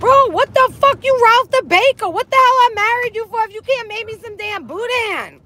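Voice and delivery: mock outrage. Mock quarrel between spouses.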